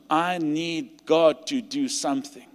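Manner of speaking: normal talking voice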